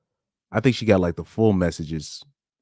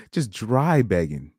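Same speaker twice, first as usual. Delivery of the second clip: slow words with a laugh; 4 semitones higher than usual. Real, not acted. Commenting on the girl's messages, laughing. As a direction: laugh it off in disbelief.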